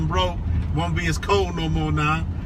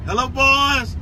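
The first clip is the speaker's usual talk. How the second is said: higher, hearty roll-call hello; calling out a greeting; 8 semitones higher, 4 dB louder, slower than usual. Real, not acted. Greeting viewers' boys on roll call, calling out.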